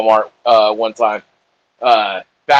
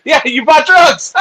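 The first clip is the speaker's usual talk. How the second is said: much higher, slow words through laughter; giggling jab at a friend; laughing teasingly; 12 semitones higher, 4 dB louder, slower than usual. Real, not acted. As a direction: tease him while cracking up.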